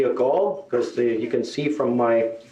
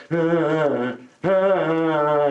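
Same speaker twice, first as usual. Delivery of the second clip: drawn-out mock crying; imitated child wail; much slower than usual. Comedian imitates a kid crying in shop, mock wail. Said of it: "There is a kid crying over there".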